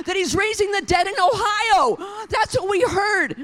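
loud, high, shouted preaching; preaching at full force. Acted. Pastor shouting to congregation about raising the dead, full force preaching. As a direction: pastor crying out the miracle to the congregation.

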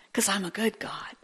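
soft unvoiced whisper; whispered aside. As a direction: lean in and whisper it.

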